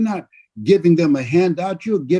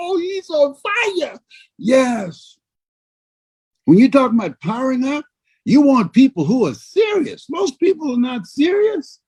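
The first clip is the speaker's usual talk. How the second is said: high, shouted preaching; fired-up shout; 9 semitones higher than usual. Real, not acted. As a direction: fire up the audience. Preacher fires up the crowd.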